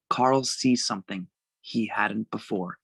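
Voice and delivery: usual speaking voice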